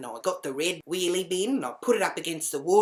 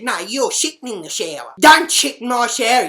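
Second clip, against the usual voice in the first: raised, shouted telling-off; angry accusation; 7 semitones higher, 13 dB louder than usual. Retold or imitated. Arguing with the housemate through the door, angry shouting.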